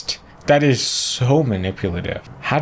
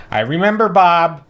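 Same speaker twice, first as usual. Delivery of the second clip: mocking; 7 semitones higher, 4 dB louder than usual. Mocking the guest, exaggerated.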